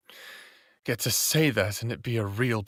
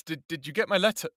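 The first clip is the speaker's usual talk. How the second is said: stumbling over words; 7 semitones higher, faster than usual. Acted. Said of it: "I stopped stumbling over my words".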